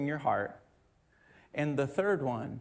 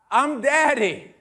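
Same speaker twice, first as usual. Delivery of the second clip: indignant correction; 9 semitones higher, 9 dB louder, slower than usual. Retold or imitated. Dad correcting sleepy daughter.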